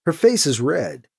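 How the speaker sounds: ordinary delivery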